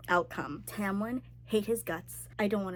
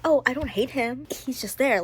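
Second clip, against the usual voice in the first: mock dismissive; 4 semitones higher, 8 dB louder than usual. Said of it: "I was like".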